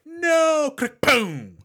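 high mock wail, then separate click and boom; delayed gunfire imitation. Shown as a demonstration. Showing the gun's clumsy delay, mock wail then lagging boom. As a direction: cry out first, fire late and slowly. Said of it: "there's a real delay in the drama".

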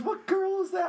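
a hammed-up jealous outburst; mock outrage. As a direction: squawk it like a jealous friend. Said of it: "He goes".

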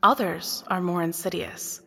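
normal talking voice

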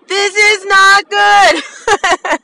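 high, slow, drawn out into a laugh; amused self-reproach; laughing exclamation. Real, not acted. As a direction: stretch each word, about to crack up. Laughing exclamation about own tooth.

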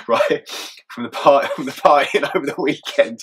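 real amused laughter breaking into the words; laughing. Real, not acted. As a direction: crack up while telling it. Laughs telling the sex toys story.

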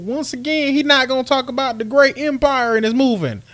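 high-pitched put-on whine of complaint; mock listener voice. Host voicing doubting listeners, mock high complaint. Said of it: "I know y'all think".

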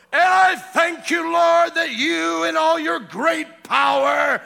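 booming, slow, high-pitched pompous prayer; mock grand prayer. Preacher mocking a showy prayer, booming and slow. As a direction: shout it like an old-fashioned formal prayer. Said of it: "Michael the Archangel and Gabriel's like, "Listen to this... in Elizabethan".

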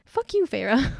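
half-joking fed-up curse; mock angry retort. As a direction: snap it at a book character. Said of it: "And I'm like".

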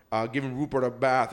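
usual speaking voice

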